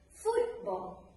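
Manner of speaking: "football" stressed on FOOT, BALL dropping; stress on first noun. Shown as a demonstration. Teacher showing how we pronounce it, stress on FOOT in "football". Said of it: "So we pronounce it".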